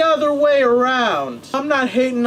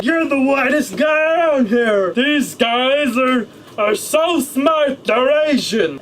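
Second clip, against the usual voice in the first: put-on drunk guy yelling; 2 semitones higher than usual. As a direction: bellow it like the tipsy stranger. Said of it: "decided to insult".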